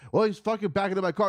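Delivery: high, quick indignant retort; imitating an exasperated complaint; retold heated protest. Retelling his own heated reply.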